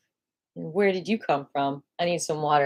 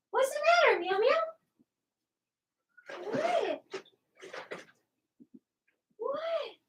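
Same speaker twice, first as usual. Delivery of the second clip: much higher, soft pet talk; 6 dB quieter than usual. Real, not acted. Talking sweetly to her cat.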